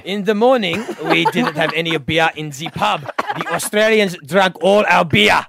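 put-on thick comic Russian accent. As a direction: say it like a Russian barman. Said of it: "you can do a Russian accent".

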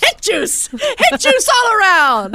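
joking toast to friend, high and hammed-up; mock toast. Real, not acted. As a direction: announce it like a grand toast. Joking toast of hick juice.

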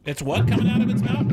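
shocked disbelief. Real, not acted. Caller says a lung comes out.

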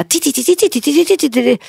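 high ticking 'טי טי טי' imitating a clock counting down; ticking timer sound. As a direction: tick it out like a nervous timer. (חיקוי גבוה של תקתוק שעון)

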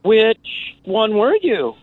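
a cheeky punchline; deadpan joke reply. Retold or imitated. Comedian joking with the murder-suicide survivor, high mock-innocent.

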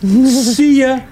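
high, singsong mock goodbye; breezy send-off. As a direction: imitate a diver cheerfully waving off a shark. Acting the unbothered diver's reply.

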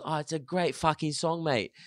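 put-on high English accent. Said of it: "He goes".

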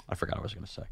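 fading to a whisper; trailing off. Real, not acted. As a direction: lose the thought and go quiet. Loses train of thought.

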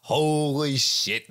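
drawn-out, high-pitched exclamation; awed excitement. Real, not acted. Man raving about Celtics box seats, excited. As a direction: stretch it out high, really impressed.

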